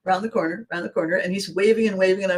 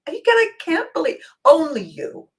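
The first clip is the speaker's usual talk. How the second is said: gushing admirer; 11 semitones higher, 3 dB louder than usual. Retold or imitated. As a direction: rave about her. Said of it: "And he said".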